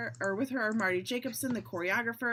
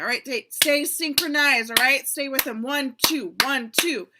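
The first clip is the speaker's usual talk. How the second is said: loud, higher-pitched dance coach; calling the count; 4 semitones higher, 9 dB louder than usual. Acted. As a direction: drive the dancers in time over music.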